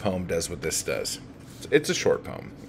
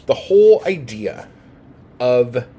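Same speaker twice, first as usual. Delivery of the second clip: irritated teacher bursting out; pissed-off emphasis; 8 dB louder than usual. Real, not acted. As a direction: hammer the point home out of real annoyance. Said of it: "but I'm fucking pissed off right now".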